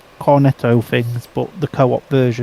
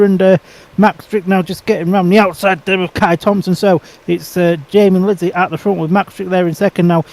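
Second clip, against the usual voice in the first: lively play-by-play, a bit higher; race commentary; 4 semitones higher than usual. Pretend. Mock live race commentary.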